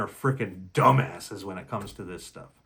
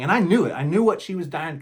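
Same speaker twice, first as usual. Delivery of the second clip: ordinary delivery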